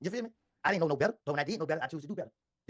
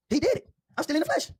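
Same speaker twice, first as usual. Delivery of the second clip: raised, high-pitched insistence; emphatic self-defense; 8 semitones higher, 6 dB louder than usual. Real, not acted. Defending himself against the accusation, loud and insistent. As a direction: drive it home loud and high.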